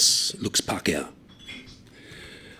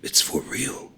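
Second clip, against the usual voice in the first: hushed, breathy near-whisper; a secret told close; quiet; 4 semitones higher, 5 dB quieter, slower than usual. A narrator's reading. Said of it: "he says quietly".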